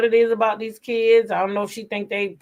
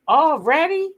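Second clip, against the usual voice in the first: high, loud surprised already; 10 semitones higher, 4 dB louder than usual. Real, not acted. Hearing a viewer already picks vegetables, surprised. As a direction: blurt it out amazed.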